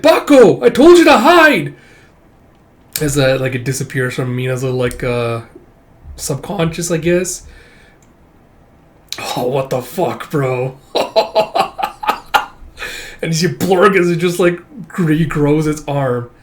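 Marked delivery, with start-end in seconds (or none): 0.0-1.7 high, loud, desperate cry; alarmed scolding shout. Acted. Girl cries out to tiger. 10.9-13.2 breathless, unvoiced laughing; stunned laugh. Real, not acted. Reacts to shocking panel.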